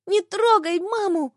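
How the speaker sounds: put-on high-pitched child's protest; crying-out little boy. A narrator's reading. Son defends his mother, high childish cry. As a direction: cry out in a small boy's high voice. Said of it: "закричал Платон".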